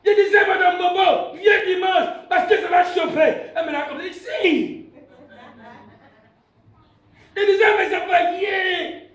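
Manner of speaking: fervent, shouted sermon storytelling; impassioned preaching. Real, not acted. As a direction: preach it out with rising excitement. Preaches at full force. (prédication passionnée, à voix forte)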